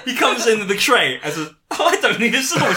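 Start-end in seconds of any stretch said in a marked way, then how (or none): 1.7-2.8 Bond voice. Acted. Acting out Bond in comic scene.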